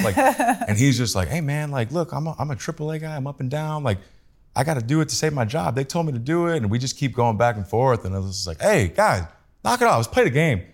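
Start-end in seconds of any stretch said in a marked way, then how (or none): 1.3-6.6 higher, pleading ballplayer voice; higher whiny excuse from the triple A guy; mock player excuse. Player pleading to justify his bunt. 8.6-10.6 higher, louder call to knock it off; raised voice telling both guys to knock it off; breaking up argument. Retold or imitated.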